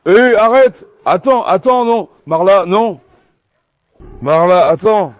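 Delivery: loud, repeated calls to stop; urgent shouted commands. Real, not acted. Owner breaking up a dog scuffle, urgent shouting. Breaking up dogs about to fight.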